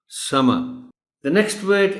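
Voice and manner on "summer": summer ending in "uh" without r; correct, silent r. Shown as a demonstration. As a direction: model summer firmly as "sum-uh". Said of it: "it is not to be pronounced clearly". (summer آخری r کے بغیر)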